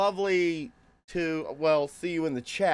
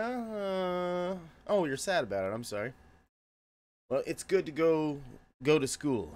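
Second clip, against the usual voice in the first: usual speaking voice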